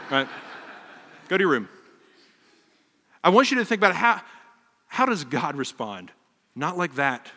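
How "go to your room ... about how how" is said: raised, emphatic parental command; louder dad order. Retold or imitated. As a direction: bark it a bit louder at the kid. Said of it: "my response to them".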